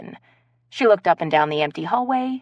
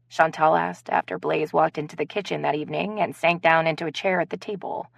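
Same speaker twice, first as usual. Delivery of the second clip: ordinary talk, nothing marked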